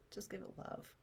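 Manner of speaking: breathy whisper of comfort; soft whispered repeat. Shown as a demonstration. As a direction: breathe it out softly. Repeated soothing phrase.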